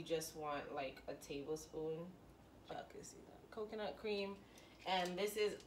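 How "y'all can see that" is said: whispered aside to viewers; quiet whisper to the camera. Real, not acted. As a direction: breathe it with no voice.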